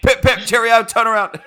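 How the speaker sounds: high, loud, hammed-up British English; badly faked British accent, breaking into laughter; mock British accent.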